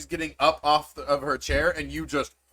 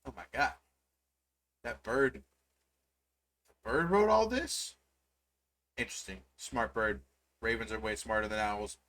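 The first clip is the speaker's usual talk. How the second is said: dad voice, quiet; 5 semitones lower, 10 dB quieter than usual. Acted. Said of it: "and he goes".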